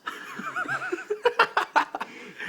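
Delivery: bursting into a high laugh. Real, not acted. Narrator cracking up at his own aside, high laugh.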